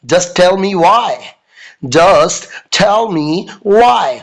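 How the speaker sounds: very slow, pressing demand; demanding teacher. Retold or imitated. Teacher presses Raju for his reason. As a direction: draw out why. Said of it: "madam बोलती है".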